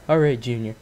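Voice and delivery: soft, dejected, low delivery; sad voice. Retold or imitated. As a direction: sound wounded, close to crying. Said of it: "in the sad voice, like he's about to cry".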